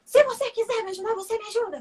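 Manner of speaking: high, loud mock angry outburst; acted-out irritated yell; imitating yelling passenger. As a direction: snap it back as a retold irate passenger. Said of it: "já vi ele gritando".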